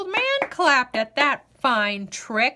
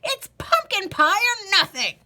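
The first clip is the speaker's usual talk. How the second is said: loud, cracked refusal; old man sulking over pie; stubborn old man; 5 semitones higher, 5 dB louder than usual. Acted.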